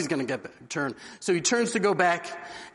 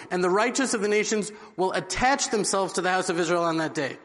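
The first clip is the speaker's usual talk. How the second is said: ordinary talk, nothing marked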